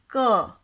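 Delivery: क said loud and clear; teacher introducing the first consonant; consonant demo. Shown as a demonstration. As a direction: sound out क for the class. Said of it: "क को बोलकर एक लंबा sound".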